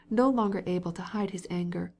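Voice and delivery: plain reading voice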